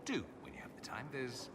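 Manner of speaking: very soft, coy invitation; coy hint. Acted. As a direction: drop a hint very softly. Coy hint about a gift in quarters.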